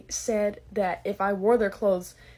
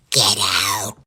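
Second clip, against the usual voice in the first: very low, menacing growl; deep creepy growl; 13 semitones lower, 7 dB louder than usual. Acted. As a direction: growl it in their ear. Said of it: "I creep up in their ear".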